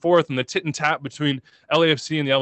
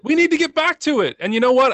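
high, strained and louder; emotional; 10 semitones higher, 3 dB louder than usual. Real, not acted. Guest urging fans to return, strained and emotional. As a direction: urge it out, voice stretched tight. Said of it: "I'm emotional".

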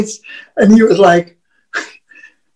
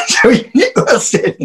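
ordinary talk

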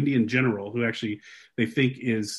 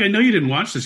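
laughing tease; 9 semitones higher, 7 dB louder than usual. Retold or imitated. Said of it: "I'm like".

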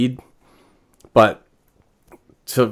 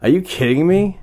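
incredulous; 8 semitones higher than usual. Retold or imitated. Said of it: "I'm like".

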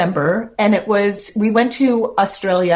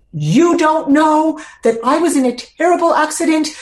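defensive retort; 7 semitones higher than usual. Retold or imitated. Confronting strangers who judged her.

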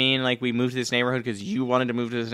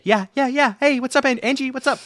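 much higher, louder sing-song greeting; bright phone hello; 8 dB louder than usual. Acted. Wife answering husband's call, high and chirpy. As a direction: switch to a cheery phone voice.

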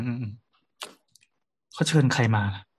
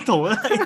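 bursting into a high laugh; high burst of laughter. Real, not acted. Reacting to the co-host's answer, high burst of laughter. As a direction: let a high cackle break out around the words.

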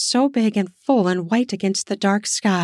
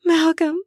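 much higher, drawn-out sigh on his name; high pleasured moan. Acted. Kelly sighing Malcolm's name in pleasure. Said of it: "horn dog music to his ears".

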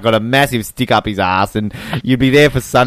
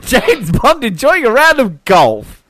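mock dramatic; 9 semitones higher, slower than usual.

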